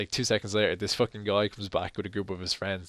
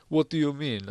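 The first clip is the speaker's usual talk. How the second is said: higher-pitched mock Spanish accent; imitated Spanish accent; 5 semitones higher than usual. Suspected thief answering the accusation, imitated Spanish accent. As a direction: retell it in a light Spanish accent. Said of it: "obviously Spanish accent and stuff".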